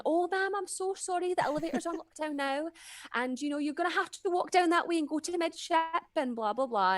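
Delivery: high, put-on Scottish customer service voice. Scottish elevator worker apologizing to passenger, customer service voice. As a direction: imitate a Scottish friend placating a passenger, pitched high. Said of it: "trying to put her customer service voice on".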